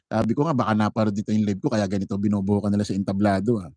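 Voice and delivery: ordinary talk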